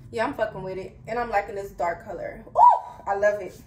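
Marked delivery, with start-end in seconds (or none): 2.5-3.0 quick high, loud "ooh"; sudden high-pitched coo of approval; delighted squeal. Real, not acted. Delighted at the hair color.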